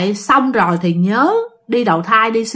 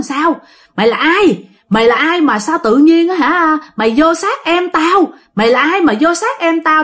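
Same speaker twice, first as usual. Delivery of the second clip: higher-pitched, acted angry aunt; mock-angry questioning, pitched up; scolding interrogation; 6 semitones higher than usual. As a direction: demand answers in a raised, scolding voice. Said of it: "hai người dì mới ngưng chửi".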